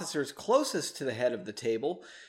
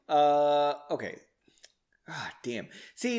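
ordinary delivery

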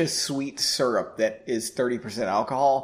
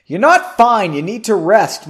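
loud, higher-pitched comeback; sharp retort; 6 semitones higher, 11 dB louder than usual. A narrator's reading. Margot arguing that Henry must rest, loud sharp retort. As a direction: fire back forcefully in an argument. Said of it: "Margot retorted".

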